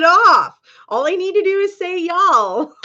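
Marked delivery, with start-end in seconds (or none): 2.0-2.7 mock Southern drawl. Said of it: "I look like a Southern belle".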